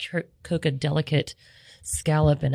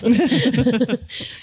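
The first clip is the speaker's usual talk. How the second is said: laugh; 9 semitones higher, 4 dB louder than usual. Real, not acted. Laughs at own stress.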